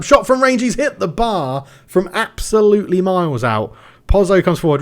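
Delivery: gasping at the near goal; excited commentary. Real, not acted. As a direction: call the shot as it flies.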